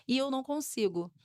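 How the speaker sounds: ordinary talk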